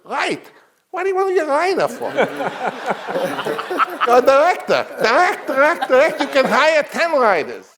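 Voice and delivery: very high, loud, fast mogul voice; Sam Goldwyn, exasperated. Retold or imitated. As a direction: bark it out like a flabbergasted studio boss. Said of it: "he talked like I'm gonna talk".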